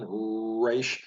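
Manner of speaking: "Reish" with a drawn-out, lip-rounded English R; wrong English R. Shown as a demonstration. Teacher showing the wrong way to say it, English R. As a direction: hold a puckered English R at the start of "Reish". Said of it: "that's the wrong way".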